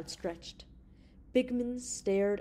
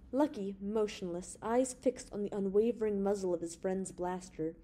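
plain reading voice

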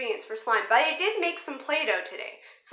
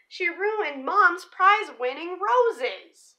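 lively picture-book read-aloud; story narration. Acted. Reading a dinosaur picture book to viewers, storybook voice. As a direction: read the rhyming story to the kids.

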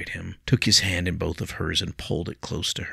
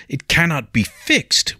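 loud, higher, slow cutting retort; heated; 10 semitones higher, 8 dB louder, slower than usual. A narrator's reading. William cutting Leah off, heated and loud. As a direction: cut her off hot and hard. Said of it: "William cut her off heatedly".

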